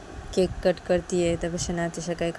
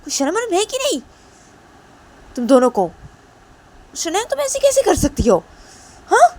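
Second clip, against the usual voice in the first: shrill furious scolding, much higher and louder; 13 semitones higher, 10 dB louder than usual. Acted. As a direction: scold her in outrage, pitch way up. Mother furious at daughter's behaviour.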